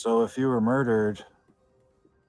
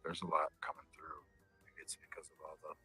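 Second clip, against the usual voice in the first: whispering; 3 semitones lower, 9 dB quieter than usual. Real, not acted. Eerie cemetery, sensing spirits.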